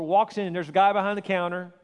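ordinary talk

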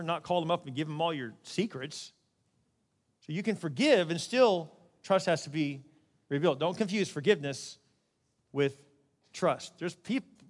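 usual speaking voice

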